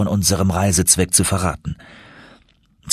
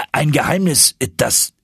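flustered stammer, pitched up; 7 semitones higher than usual. A narrator's reading. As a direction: falter and break off mid-thought. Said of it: "stotterte mein Onkel".

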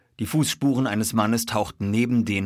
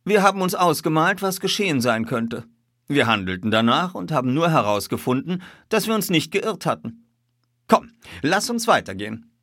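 higher, lively detective's voice; lightly voiced detective; 6 semitones higher, 4 dB louder than usual. A narrator's reading. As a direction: talk it through briskly as the detective.

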